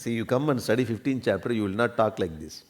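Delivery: usual speaking voice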